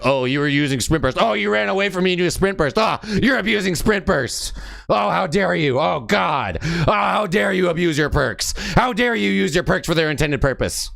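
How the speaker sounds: over-the-top mock gasp of offense; sarcastic mock outrage. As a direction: ham up fake indignation to ridicule them.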